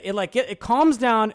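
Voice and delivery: usual speaking voice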